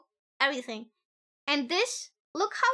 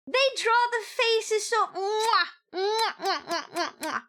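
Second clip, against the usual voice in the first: gushing kisses; 6 semitones higher, slower than usual. Real, not acted. Smooching sounds over art.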